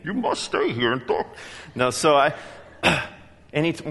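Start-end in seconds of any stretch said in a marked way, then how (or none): 0.0-1.3 Bane voice. Retold or imitated. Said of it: "And Bane".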